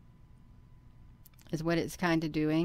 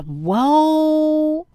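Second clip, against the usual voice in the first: long, loud, rising whoa; amazed outburst; 10 semitones higher, 12 dB louder than usual. Real, not acted. Vlogger sees the ship move on screen, loud amazement. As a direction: let real astonishment swell upward.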